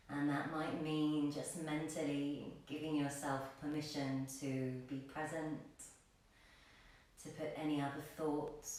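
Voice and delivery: soft, low meditation voice with long pauses; guided meditation. Acted. Yoga teacher guides class into grounding breaths, soft meditation voice. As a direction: settle them gently into the breath, hushed and unhurried.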